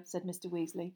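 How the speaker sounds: plain reading voice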